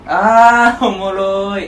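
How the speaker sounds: loud, high, drawn-out mock appreciation; mocked fake amusement. Mimicking people who say it's funny, exaggerated fake amusement. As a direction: imitate someone claiming it's funny. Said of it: "って言うやつ".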